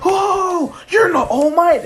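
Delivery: very high, loud mock scream; shocked outcry. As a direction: shriek at the hero's transformation. Boy sees hero transform.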